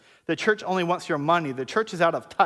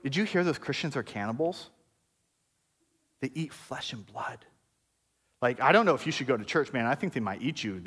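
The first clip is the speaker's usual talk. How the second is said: hushed gossip; 6 semitones lower, 5 dB quieter than usual. Retold or imitated. Neighbors whispering rumors that Christians are cannibals, hushed gossip. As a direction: lean in and spread the scandal quietly. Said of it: "this community would go".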